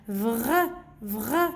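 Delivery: "vr" with a long throaty R; blended consonants. Shown as a demonstration. Teacher repeats for the children, blended consonants. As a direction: sound out "vr" as one blend. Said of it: "il faut juste savoir comment prononcer".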